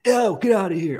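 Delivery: high, loud shoo, grossed out; disgusted shooing yell. Retold or imitated. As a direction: shriek at the fly to leave. Shooing away a fly, disgusted.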